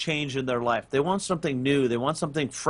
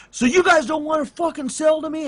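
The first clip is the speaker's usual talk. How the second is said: mock furious yell, pitched way up; imitating an angry outburst; 12 semitones higher, 3 dB louder than usual. Old lady customer yelling at the staff, in a mock furious high voice. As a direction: ham up the customer losing it. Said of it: "she just went ballistic".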